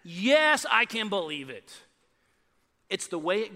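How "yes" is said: ordinary delivery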